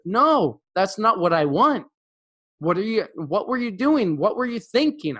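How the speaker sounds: high, insistent, demanding an answer; mounting exasperation. Retold or imitated. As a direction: press her hard for a real answer. Teacher losing his temper.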